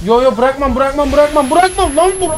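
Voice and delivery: real frantic insistence; desperate refusal. Real, not acted. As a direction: hold on for dear life, building. Refusing to let go in game.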